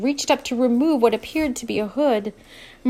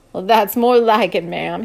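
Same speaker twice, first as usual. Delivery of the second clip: cowboy voice; 7 dB louder than usual. Acted. Luke's voice.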